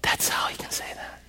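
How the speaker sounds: breathy whisper to the congregation. Real, not acted. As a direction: lean in and whisper it. Sermon climax whispered.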